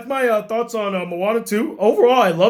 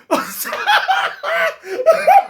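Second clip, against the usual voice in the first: disbelieving laugh. Real, not acted.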